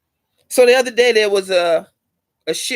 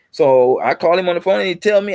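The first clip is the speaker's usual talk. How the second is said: ordinary talk, nothing marked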